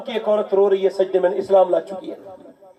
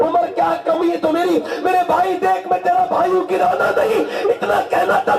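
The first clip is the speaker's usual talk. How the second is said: high, urgent preacher's plea; impassioned pleading sermon; 10 semitones higher than usual. Acted. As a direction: beg with fervour. Boy pleading with brother, preached.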